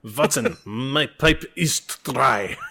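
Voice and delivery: very slow, hammed-up German accent; mock German detective complaining; German Sherlock Holmes. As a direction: drawl it like a comic German Holmes. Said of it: "The German Sherlock Holmes".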